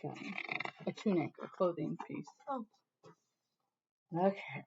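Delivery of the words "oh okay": ordinary delivery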